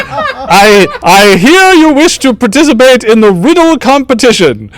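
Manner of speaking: high-pitched, loud court jester. Acted. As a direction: pipe up as a jester welcoming contestants. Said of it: "the jester is like".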